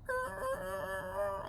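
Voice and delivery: very high, drawn-out tire squeal; imitated screech. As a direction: squeal like dragging rubber. Imitates sound of dragging tires.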